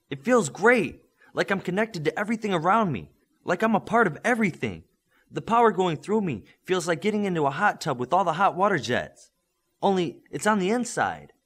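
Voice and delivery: put-on high, eager young man's voice; young hero Midas. A narrator's reading. Midas telling his coach what he feels, eager and high. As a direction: let the wonder spill over.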